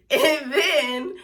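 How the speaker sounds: high laugh spilling into the words. Real, not acted. Laughing off her sad months, high laugh. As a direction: laugh it off and carry on.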